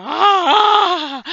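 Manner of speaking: high, loud delighted squeal; excited squeal "Ah". Retold or imitated. Gushing at the Irish ninja, high squeal. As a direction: squeal with glee. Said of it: "which made me go".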